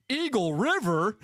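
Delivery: high, slow incredulous question; astonished barracks chorus. Retold or imitated. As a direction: imitate a crowd's amazed question, drawn out. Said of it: "the entire barracks goes".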